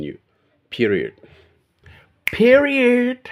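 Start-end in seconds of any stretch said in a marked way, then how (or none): 2.2-3.3 shrieked in a very high pitch; squealed high. Retold or imitated. Repeats the word Peterson wrote.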